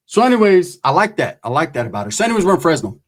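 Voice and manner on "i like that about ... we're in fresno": ordinary talk, nothing marked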